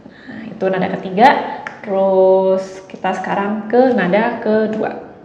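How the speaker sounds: usual speaking voice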